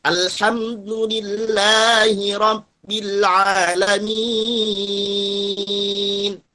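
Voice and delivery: Alhamdulillahirrabbilalamin recited very slowly with thin A through a smile; smiling thin vowels. Shown as a demonstration. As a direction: draw out Alhamdulillahirrabbilalamin, lips smiling, last syllable held long. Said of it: "Jadi senyum dia".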